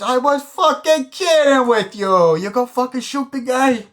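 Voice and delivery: very high, slow, loud protest; exasperated shouting. Retold or imitated. Gangster protests he was only kidding, shouting shrilly. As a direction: scream it in acted disbelief, stretching the words. Gangster yells about shooting, voiced.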